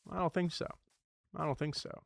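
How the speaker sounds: a light, hushed take on Elastigirl, firm and motherly; lightly voiced superhero mom shutting it down; soft, understated Elastigirl impression. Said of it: "that was my Elastigirl voice".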